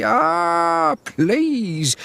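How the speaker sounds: high slow cry at the gate; shouting. A narrator's reading. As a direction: call out high and slow to chase the cow off. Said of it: "shouted very loudly".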